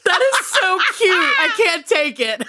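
high, loud delight through laughter; real helpless laughter; laughing hard. Real, not acted. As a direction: talk while cracking up. Cracking up at the voicemail.

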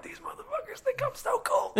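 very high, hushed comic inner voice; mock smug Jesus. Comic imagining Jesus's thoughts while walking on water, high mock-smug voice. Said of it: "I imagine he walked on water and was like".

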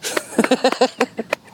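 high, rippling giggle; short burst of high laughter; light laugh. Real, not acted. Singer just done demonstrating her song, light laugh.

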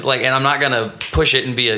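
usual speaking voice

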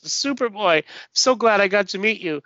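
lightly voiced starstruck girl. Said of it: "And she says".

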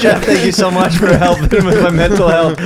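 loud high mocking caller voice; mocking impression. Rereading caller message, mocking.

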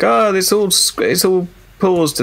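high, slow, hammed-up dismay; mock dismayed pilot. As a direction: whine it like a baffled pilot. Mocking a pilot whose feed froze.

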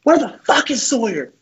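high-pitched mock yell of an angry coach; put-on coach outburst; Coach Garrido. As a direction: imitate a coach calling out the culprit. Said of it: "He goes".

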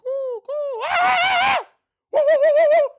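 very high rainforest monkey hoots; imitated monkey screams. Sleep sounds heard in bed, imitated monkey screams. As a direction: hoot and shriek like a monkey. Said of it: "screaming on this rainforest".

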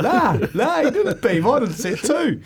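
high, laughing reply to her partner. Retold or imitated. Retelling, laughing at the mishap.

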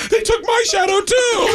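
high, slow wailing complaint with a mock sob; crying whine. Friend on acid wailing to the teller about his shadow, high slow mock sob. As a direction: whimper it like a tripping friend who lost his shadow.

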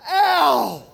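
short high yelp of pain, overdone; hammed-up ow of pain. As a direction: yelp "Ow!" like a ham actor. Actor repeats hammed pain cry.

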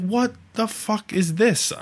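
high, slow, stunned outburst; retold shock. Reliving the car dying in traffic, high and slow. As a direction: stretch it out in disbelief.

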